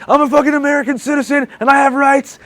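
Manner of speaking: high, loud re-enacted outrage; screaming protest. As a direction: yell it like an indignant detainee. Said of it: "kicking and screaming at this moment".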